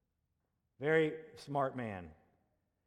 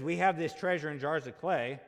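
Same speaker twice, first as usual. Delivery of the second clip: louder, pressing hard on "have"; emphatic louder reading; 4 semitones higher, 7 dB louder than usual. Shown as a demonstration. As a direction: proclaim the verse with force on "have". Verse repeated for emphasis.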